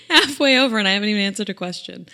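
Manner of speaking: laughing. Real, not acted. Podcaster owning up to a long tangent, laughing. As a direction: rush it out on the tail of a laugh.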